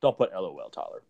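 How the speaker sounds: low, soft teasing imitation; mocking Joe. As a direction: drop low to parody him.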